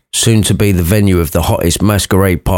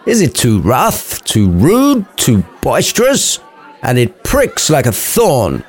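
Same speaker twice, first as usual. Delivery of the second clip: cried out; 5 semitones higher than usual. A narrator's reading. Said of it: "cried Romeo".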